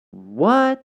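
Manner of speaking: high, rising mock disbelief; hammed-up confused "what?"; incredulous. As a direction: pitch shoots up in bafflement. Retold baffled reaction to child.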